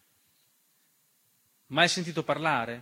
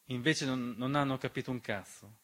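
bitter quiet; 4 semitones lower, 8 dB quieter than usual. Acted. Bitter letdown after hope.

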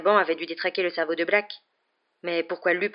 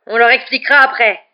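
loud, high, angry retort; furious raised voice; roared; 6 semitones higher, 12 dB louder than usual. A narrator's reading. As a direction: thunder it back impatiently.

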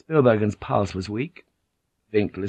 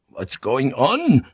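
mildly frightened, raised question; 5 semitones higher, 4 dB louder, slower than usual. A narrator's reading. Spielbergen alarmed by the standoff, frightened. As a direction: ask in alarm, drawing it out.

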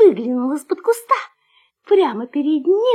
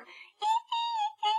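tiny, very high soft squeals; mouse squeak; 13 dB quieter than usual. A narrator's reading. Little mouse startled by the puppy, tiny high squeak. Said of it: "запищал мышонок".

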